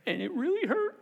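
high-pitched imitation of a woman starting to cry; imitated tearful woman. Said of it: "And she starts".

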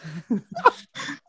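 giggling fit, high and breathy; can't hold back the giggle. Real, not acted. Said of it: "Stop laughing".

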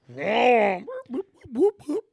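high whooping police siren noise; siren imitation. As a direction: mimic a cop siren, rising whoops.

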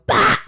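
very high, very loud sudden bah; loud kid yell. Retold or imitated. Toddler running up to a kid and yelling bah, very loud and high. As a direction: blast a startling bah at another kid. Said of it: "he would run up to them and go".

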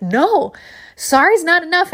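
high, loud indignant cry; refusing the chiropractor's apology, loud and high; angry refusal. Retold or imitated. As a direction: refuse his apology at full volume. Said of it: "And I was like".

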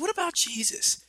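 very high put-on question; high-pitched parent voice; parent prompting child. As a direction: gently quiz a small child in a high voice. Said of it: "I was like".

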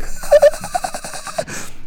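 very high relentless giggle; imitated laughter. Laughter that won't leave him, high-pitched cackle. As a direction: cackle on without letting up. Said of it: "It won't leave".

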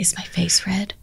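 embarrassed whisper; breathy aside, whispered. Real, not acted.

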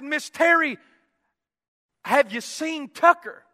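anxious asking. Retold or imitated. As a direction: ask urgently after your missing child. Worried father asking for missing son.

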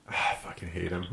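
ordinary delivery

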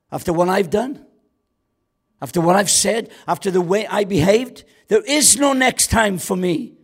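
high, loud despairing cry; preaching at full force. Acted. Preacher voicing Peter's despair, loud and high.

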